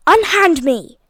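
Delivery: loud, indignant bellow. A narrator's reading. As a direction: roar it at the policemen. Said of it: "bellowed Lord Hastings".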